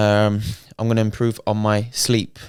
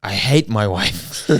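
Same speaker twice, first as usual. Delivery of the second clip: slow, deliberate put-on voice; mock put-on voice; slower than usual. Answering the kid in the game lobby, slow mock voice. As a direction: draw each line out in a mock voice. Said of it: "And then I went".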